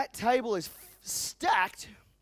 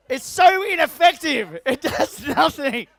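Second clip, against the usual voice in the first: laughing, much higher and louder; 6 semitones higher, 13 dB louder, slower than usual. Real, not acted. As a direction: laugh through it, slower. Laughing at the gesture.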